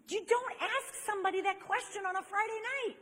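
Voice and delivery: high-pitched mock outrage; exasperated scolding. Woman scolds friend for his Friday night question, exasperated.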